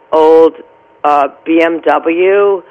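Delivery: ordinary talk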